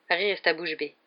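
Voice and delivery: plain reading voice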